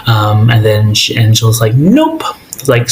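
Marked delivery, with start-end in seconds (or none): 1.8-2.2 very high, rising mock refusal; sing-out snub, pitched way up; sassy refusal. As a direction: imitate a cheeky brush-off, pitch shooting up. Acting out Angela's curt, sassy refusal.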